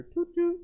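high-pitched toot toot; train whistle imitation. Storyteller miming the train conductor's whistle, high toots.

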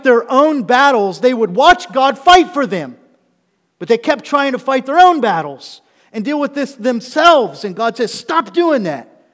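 loud, high, driving pulpit proclamation; full-force preaching. Acted. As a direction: preach it out, stretching the key words.

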